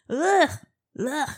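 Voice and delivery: short guttural noise of disgust; playful gag of revulsion; disgusted ugh. Real, not acted. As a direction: recoil with a yuck sound. Disgusted at the idea of smelling.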